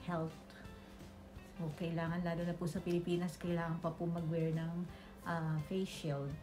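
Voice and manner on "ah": normal talking voice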